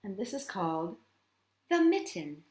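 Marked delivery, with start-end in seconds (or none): none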